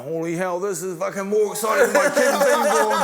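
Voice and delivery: awestruck mate. Retold or imitated. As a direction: imitate a thrilled friend, building in pitch and volume. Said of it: "My mate's going".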